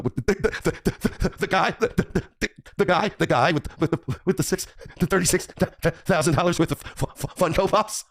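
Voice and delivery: high, hammed-up stuttering; mock stammer. Streamer ridiculing Jeremy over his Funko Pops, high mock stammer. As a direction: get stuck on every word, pitched high in ridicule.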